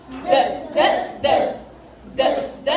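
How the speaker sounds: "D" as a short "duh", not the letter name; letter-sound D. Shown as a demonstration. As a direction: tap out each "D" as a brief voiced burst. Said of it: "Qual é o som da letra D".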